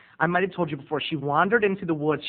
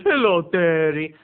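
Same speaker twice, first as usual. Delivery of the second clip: slow, loud, cold hello; drawn-out hostile hello; mean greeting; 5 dB louder, slower than usual. Retold or imitated. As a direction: imitate a glaring aunt's unfriendly greeting. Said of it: "she just gave me this mean look".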